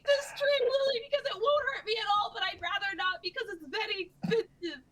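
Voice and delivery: put-on tearful German accent. Hammed-up German character pleading.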